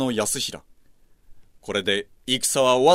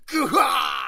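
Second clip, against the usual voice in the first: death cry; 12 semitones higher, slower than usual. Acted. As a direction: let out a pained cry as he falls. Benkei struck down.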